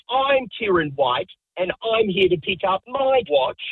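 repeating the name in a climbing, put-out voice; mock indignant. The other man says the same.

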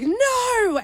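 high, drawn-out stage "no"; Juliet's cry. Retold or imitated. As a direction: imitate a tragic Juliet crying out. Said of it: "Juliet comes in and is like".

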